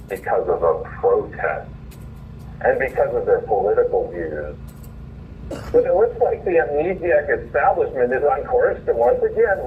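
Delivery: essay read aloud. Acted. Host reading his own essay on air, in a formal polemical read. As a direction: read it like a polemic column to the audience.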